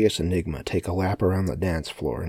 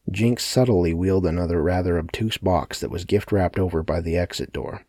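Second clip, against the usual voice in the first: ordinary delivery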